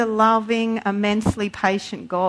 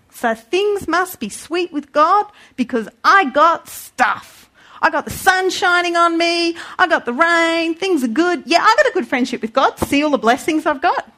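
smug boast; 5 semitones higher than usual. Acted. Mocking a smug believer.

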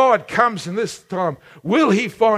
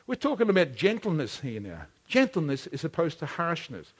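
ordinary delivery

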